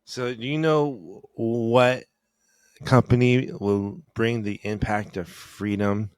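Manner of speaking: slow, loud mock commercial voice; mock ad announcer. Parody ad for a freedom company, slow and loud. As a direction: sell it like an ad.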